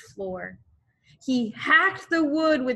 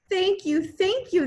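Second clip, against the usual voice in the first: high, loud overflowing thanks; mother thanking the hero; grateful cry; 10 semitones higher, 4 dB louder than usual. Acted. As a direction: gush with relief. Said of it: "the mother cried".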